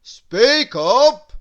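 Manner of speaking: loud, high grocer's shout; booming impatient command; shouted order. A narrator's reading. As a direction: bellow it at a timid customer. Grocer shouts at Mister Quiet.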